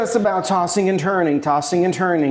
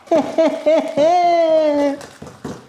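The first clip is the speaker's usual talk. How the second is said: much higher, drawn-out cackle; high laugh; 12 semitones higher, 3 dB louder than usual. Real, not acted. Cook admiring his finished pasta, high drawn-out laugh. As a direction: let out a long high-pitched laugh.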